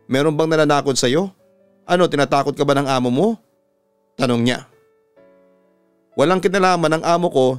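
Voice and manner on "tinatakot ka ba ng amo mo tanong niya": plain reading voice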